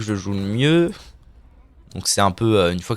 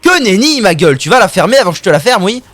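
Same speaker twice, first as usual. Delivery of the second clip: high, loud, rapid-fire trash talk; hammed-up yelling at an opponent; mock furious outburst; 9 semitones higher, 8 dB louder than usual. (engueulade surjouée, aiguë)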